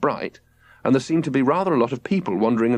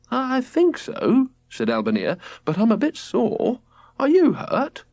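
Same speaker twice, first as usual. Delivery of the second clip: high, slow shaken answer; a sore mother reassuring; shaken wife; 12 semitones higher than usual. A narrator's reading. Albania's voice.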